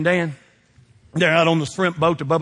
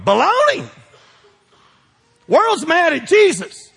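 preaching emphasis; 8 semitones higher, 4 dB louder than usual. Real, not acted. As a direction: declare it with full force.

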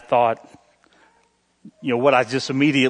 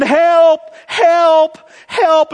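high, loud cries of help; screaming for help; 14 semitones higher, 11 dB louder, much slower than usual. Acted. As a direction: yell help while dangling from a rope. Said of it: "he's screaming".

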